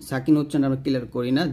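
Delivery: normal talking voice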